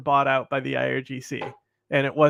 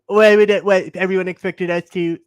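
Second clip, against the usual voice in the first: mock Trump; 3 semitones higher, 8 dB louder than usual. Mocking Trump's bragging.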